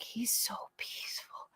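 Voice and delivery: very soft, whispered awe, as if quoting others; hushed admiration. Retold or imitated. Quoting people's awe of father.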